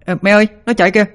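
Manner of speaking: very high and fast, tattling; shrill child head. A narrator's reading. Demon child's head calling to mother, high and quick. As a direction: blurt it out like an excited brat. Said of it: "Cái đầu Sáu Hành lại kêu lên".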